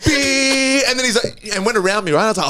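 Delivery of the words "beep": high held beep; imitated car horn. Mimicking the other driver's horn, high beep. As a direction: sound a high horn blast by voice. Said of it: "this guy was beeping".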